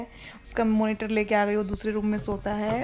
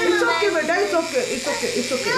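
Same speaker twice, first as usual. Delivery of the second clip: soothing; 6 semitones higher, slower than usual. Real, not acted. Soothing crying child.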